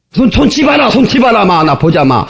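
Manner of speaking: high, rapid-fire Gyeongsang rebuff; gruff Gyeongsang dialect. Acted. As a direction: imitate a brusque Gyeongsang boyfriend snapping. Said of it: "무뚝뚝하기는 또 겁나게 무뚝뚝합니다". (무뚝뚝한 경상도 사투리로 버럭)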